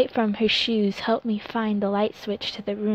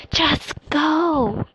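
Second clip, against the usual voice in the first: stage whisper; 7 semitones higher, 5 dB louder than usual. Acted. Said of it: "I whisper yelled".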